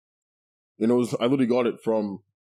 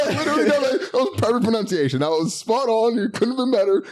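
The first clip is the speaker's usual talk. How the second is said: laughing; 12 semitones higher than usual. Real, not acted. Praising while laughing hard.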